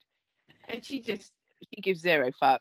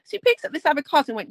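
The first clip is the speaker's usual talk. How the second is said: ordinary talk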